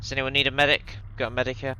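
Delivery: loud urgent call for a medic; man down shout. Real, not acted. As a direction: shout it out after the blast. IED blast, calling for medic.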